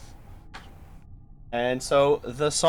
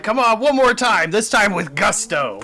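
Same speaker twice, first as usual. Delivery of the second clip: cheering on; 6 semitones higher, 6 dB louder than usual. Acted. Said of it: "They're like".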